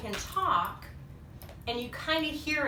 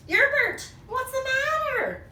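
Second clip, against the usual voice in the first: concerned call; 13 semitones higher, 4 dB louder than usual. Acted. Calling to a monster.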